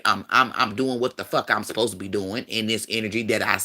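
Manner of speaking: usual speaking voice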